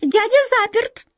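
very high tiny mouse voice; high-pitched squeaky little creature; mouse squeak. A narrator's reading. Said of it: "пискнула вторая".

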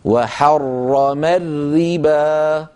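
slow recitation, thick ra in وحرم and thin ra in الربا; heavy then light ra. Shown as a demonstration. Teacher models for students, heavy وحرم, light الربا. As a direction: draw out وحرم الربا, heavy first doubled ra, light second. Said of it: "هكذا".

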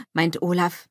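plain reading voice

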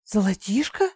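questioning rise, caught off guard; arrested surprise. A narrator's reading. Greedy goddess freezing at the offer of gold, surprised question. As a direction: stop short and query it with sudden interest. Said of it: "замерла богиня".

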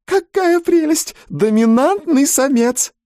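very high, slow swooning squeal; delighted squeal. A narrator's reading. Mrs Jenkins admires the dominant male, squealing. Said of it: "пискнула миссис Дженкинс".

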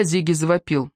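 ordinary delivery

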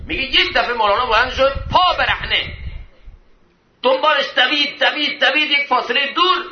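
high, driving preacher's narration; urgent sermon storytelling. Acted. Preacher recounting the scholar's barefoot chase, urgent and high.

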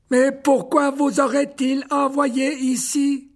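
high, slow, loud creature question; old spider, worried. Acted. Old spider Aragog reacts to Hagrid's trouble, slow and high. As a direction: draw it out with alarm, spider-like. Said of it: "reprit la créature".

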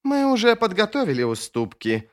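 high-pitched, cutting objection; sharp retort. A narrator's reading. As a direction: snap it back at a political rival. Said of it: "резко высказался Сульт".

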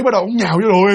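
ordinary talk

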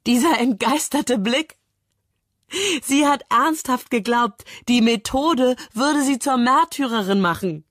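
high-pitched, mocking amazement; gleeful outburst. A narrator's reading. Kramer ridicules the condemned woman's belief, gleefully blurting. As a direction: crow over her, pitched well up. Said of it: "stößt er hervor, als er wieder sprechen kann".

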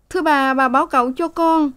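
high, begging servant voice; pleading maid. A narrator's reading. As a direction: beg the mistress, high. Sen pleads. (giọng cô hầu van nài, cao)

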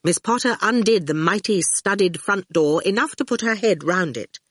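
ordinary delivery, nothing marked

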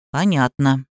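low, measured single word; curtly precise. A narrator's reading. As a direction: state it low, as if typed out. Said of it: "отпечатал Серёжа".

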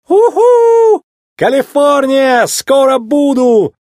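high, drawn-out elated whoops; jubilant whooping. A narrator's reading. McQueen escaping toward California, jubilant whooping. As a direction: call out in triumph, stretching every word. Escaping racer cheers as he flees.

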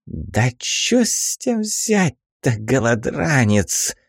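put-on scornful old woman; old crone voice. Crone sneers at dying man.

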